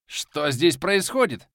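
slow, stern challenge; menacing demand. A narrator's reading. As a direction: demand it threateningly. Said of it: "грозно спросил".

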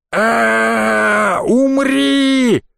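battle cry. Acted. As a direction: scream it as you lunge. Guard leaps out attacking.

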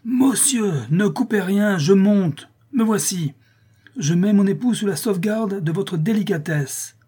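very high, frantic calling; pompous, high-pitched frantic plea; crying out full force. A narrator's reading. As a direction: shout up the stairs in panic. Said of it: "en criant de toutes ses forces".

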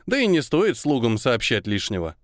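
ordinary delivery, nothing marked

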